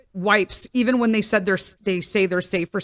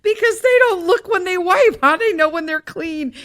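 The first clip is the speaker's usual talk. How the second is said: high, louder, slowed "on my couch" then rushing on; hammed-up indignant squeal; a friend outraged at her couch guests, mock high and loud; 10 semitones higher, 4 dB louder than usual. Retelling friend's outraged reevaluation.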